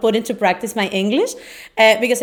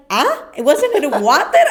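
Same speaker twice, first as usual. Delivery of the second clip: a light, higher mock of confused mishearing; confused husband; 6 semitones higher, 4 dB louder than usual. Husband mishearing kids in a lightly higher puzzled voice. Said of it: "And he's like".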